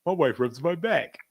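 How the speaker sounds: slow, boastful husband voice; lightly voiced friend bragging about his wife; proud husband. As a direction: savor the brag slowly. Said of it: "he goes".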